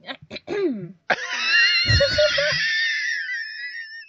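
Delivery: high shrieking burst of laughter. Real, not acted. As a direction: let out a wild screaming laugh. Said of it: "I suddenly become Tyler".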